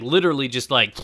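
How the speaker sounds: normal talking voice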